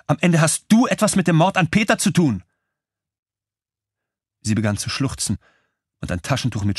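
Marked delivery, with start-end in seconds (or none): 0.1-2.4 high, loud accusing cry; even louder. A narrator's reading. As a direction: shriek the accusation of murder. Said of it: "wurde noch lauter".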